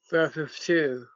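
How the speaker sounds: usual speaking voice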